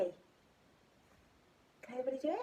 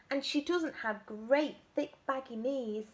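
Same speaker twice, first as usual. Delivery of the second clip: plain reading voice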